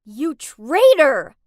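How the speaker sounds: furious, very loud drawn-out scream; full-force outraged yell. A narrator's reading. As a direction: scream the accusation in rage. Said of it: "I screamed at her".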